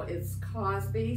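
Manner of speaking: ordinary talk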